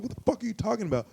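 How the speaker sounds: quick baffled comeback; fast retort. Retold or imitated. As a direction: fire it back fast. Retold confused retort.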